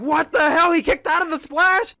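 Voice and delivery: high, loud shocked yelling; replaying a stunned outburst from stream; losing it in disbelief. Streamer retelling his shock at a kickout, yelling high. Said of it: "I remember I lost it on stream".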